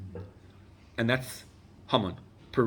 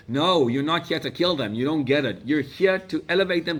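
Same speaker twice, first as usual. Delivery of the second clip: emphatic declaration; 5 semitones higher, 6 dB louder than usual. Retold or imitated. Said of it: "he says".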